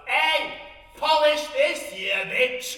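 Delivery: loud, brazen yell across the room; drunken shout. Retold or imitated. Drunk bride shouting across the wedding at the mother-in-law, loud. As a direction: bellow it from the table top. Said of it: "I shouts over to her".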